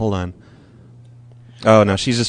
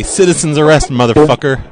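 louder citizen's arrest, punched out; loud declaration; 4 semitones higher, 7 dB louder than usual. Pretend. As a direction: announce it with force. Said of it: "and say".

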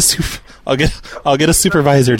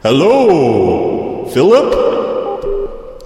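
slow, drawn-out echoing supervisor voice; fake supervisor; much slower than usual. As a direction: stretch the words like a bogus supervisor. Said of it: "Let me get the supervisor".

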